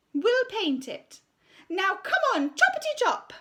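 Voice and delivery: high, slow, self-important voice; pompous art teacher. Acted. Art teacher announcing her decision to the school, pompous and high. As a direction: preen over the assembly. Miss Conceit hurries the children.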